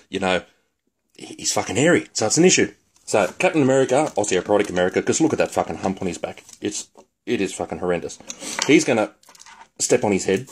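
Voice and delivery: ordinary talk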